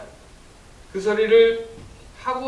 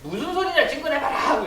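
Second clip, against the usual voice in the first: high, loud acted outrage; indignant outburst, voice jumping high; flaring anger; 11 semitones higher, 5 dB louder than usual. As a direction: snap back in hot, pitched-up denial. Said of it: "벌쩍벌쩍 막 화를 내면서".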